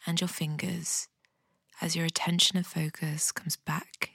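a calm guide talking someone gently down into their body; guided meditation. Acted. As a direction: ease the listener into a body scan, softly. Leads a guided body scan.

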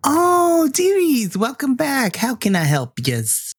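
high, sing-song granny greeting that settles back down; sweet old receptionist. Acted. Wendy the receptionist greeting the investigators, high and doting. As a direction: coo at them like a doting grandma. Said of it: "Wendy just looks at you. It's like".

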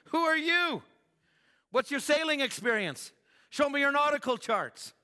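high, loud mocking challenge; scoffing shipmaster. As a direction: scoff at him like a know-it-all captain. Skeptical shipmaster challenging Paul.

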